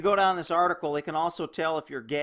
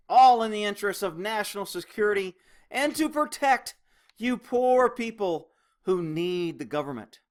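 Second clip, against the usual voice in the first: ordinary delivery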